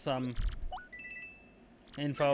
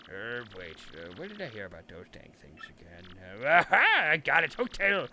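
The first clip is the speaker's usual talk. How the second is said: put-on old cranky mayor; 5 semitones lower than usual. The mayor trying to remember, low and doddering. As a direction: mumble like a forgetful elder.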